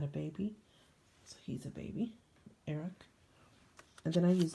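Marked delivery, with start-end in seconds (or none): 1.2-3.0 breathy hushed aside; soft unvoiced murmur; whisper. Real, not acted. Quiet aside about the baby.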